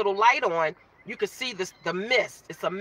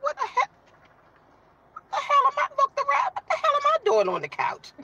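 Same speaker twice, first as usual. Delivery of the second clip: high, bewildered exclamation, much higher pitch; waking up confused. Retold or imitated. Woman waking up on the couch to her viewers, high bewildered outcry. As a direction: squeal it in disbelief. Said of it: "And I'm like".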